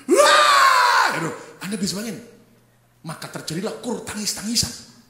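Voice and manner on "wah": loud, high-pitched bawling; hammed-up sympathetic wail; mock wail. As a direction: burst into exaggerated crying. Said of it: "Anda ikut nangis".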